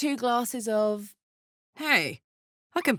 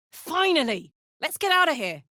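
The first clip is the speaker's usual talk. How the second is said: eager exclamation; 7 semitones higher, 3 dB louder than usual. Acted. Relief at escaping.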